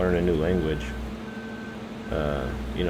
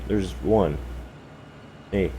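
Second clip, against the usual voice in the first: usual speaking voice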